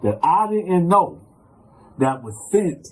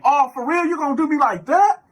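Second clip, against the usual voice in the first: hurt disbelief; 11 semitones higher, 4 dB louder than usual. Retold or imitated. Pleading with the guard.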